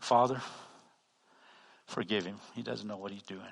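low, soft praying; acted humble prayer. Preacher prays for the rude shopper, hushed and humble. Acts his prayer for the man.

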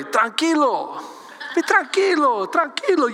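lightly acted island Spanish; island-style Spanish. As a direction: say it with a touch of Caribbean Spanish. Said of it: "how about island way?".

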